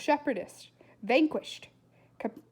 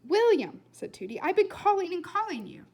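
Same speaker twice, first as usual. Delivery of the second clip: calling out; 8 semitones higher, 6 dB louder than usual. A narrator's reading. Tooty calls for William.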